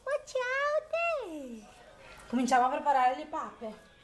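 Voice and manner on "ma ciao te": very high, slow cooing hello; sing-song greeting for the little one. Real, not acted.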